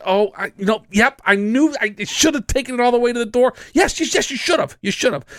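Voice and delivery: high, lightly voiced sheepish woman; mock ideal answer. Dad acting out the confession he wanted from her, high. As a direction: play her owning up, then agree sarcastically. Scripting what she should say.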